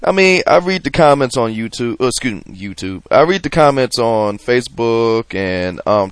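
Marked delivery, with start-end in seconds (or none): none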